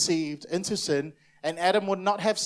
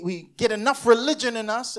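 ordinary delivery

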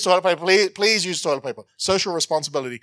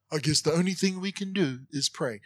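lower, softer, reluctant concession; mock resignation; 4 semitones lower, 6 dB quieter than usual. Preacher imitating half-hearted believers, resigned and subdued. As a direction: imitate someone settling for prayer as a last option. Mocking a reluctant turn to prayer.

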